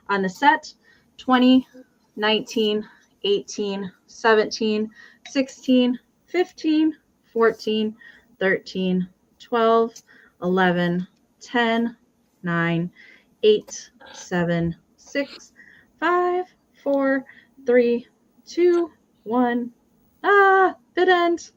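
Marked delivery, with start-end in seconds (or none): 1.2-19.7 steady rhythmic countdown call; auction countdown. Acted. Seller counting down the auction to close bids. 20.2-21.5 high excited bid end call. Real, not acted. Seller calling the end of the bid, much higher.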